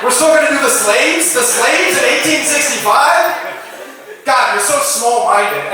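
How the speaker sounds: exasperated, rising to a yelled complaint; hammed-up outrage from eighteen sixty-five; mock exasperated plea. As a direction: plead with the crowd and then yell in disbelief. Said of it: "people were around like".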